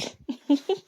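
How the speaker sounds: amused little chuckle; short laugh. Real, not acted. Narrator amused by her own aside, short chuckle. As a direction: break into a brief laugh after the joke.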